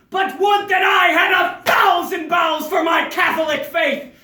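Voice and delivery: loud, high, grandiose proclamation; martyr's declamation. Acted. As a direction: shout your devotion to the heavens.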